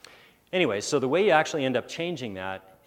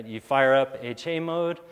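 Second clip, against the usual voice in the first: ordinary talk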